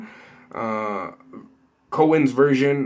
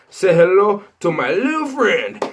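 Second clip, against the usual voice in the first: a light, slightly higher, louder stab at the mobster; Scarface impression; 6 semitones higher, 5 dB louder than usual. Showing viewers his best Scarface, lightly voiced. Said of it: "my best Scarface impression".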